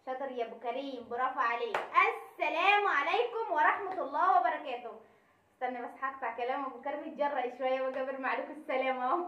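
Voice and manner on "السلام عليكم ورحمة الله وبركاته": slow loud greeting. Acted. Formal loud greeting opening.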